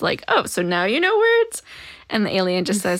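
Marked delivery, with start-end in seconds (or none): 0.3-1.6 high-pitched indignant question; acted, pitched-up objection; mock protest. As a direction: protest in pretend disbelief. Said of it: "all of the humans that are listening protest".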